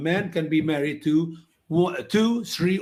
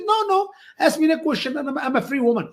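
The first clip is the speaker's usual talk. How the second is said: insistent lady's voice, much higher; voicing the lady; 9 semitones higher than usual. Lady insisting she is free, pitched higher. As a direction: wave off his worry in her higher voice. Retold talk with Iranian lady.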